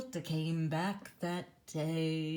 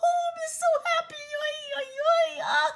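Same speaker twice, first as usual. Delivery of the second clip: very high, loud, laughing joy; hammed-up high happy wail with laughter; kvelling mother; 8 dB louder than usual. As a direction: gush over her children with tearful delight. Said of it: "that means going".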